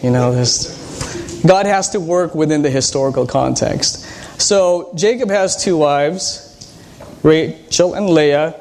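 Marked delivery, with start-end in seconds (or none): 4.4-8.6 slow, drawn-out teacher prompt; slow quiz prompt. Shown as a demonstration. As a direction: spell it out slowly for the class. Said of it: "this is teacher part coming out".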